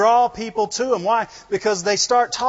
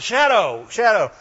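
full-voiced, brisk word-finding; mock discovery. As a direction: say it out as if just hitting on the word. Acts out landing on the word.

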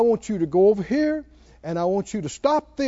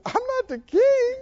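mock crying; 4 dB louder than usual. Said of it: "Kim started crying and said".

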